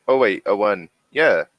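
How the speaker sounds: normal talking voice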